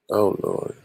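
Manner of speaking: low-key groan of dread; weary moan. Real, not acted. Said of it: "That's why I moaned when I said that".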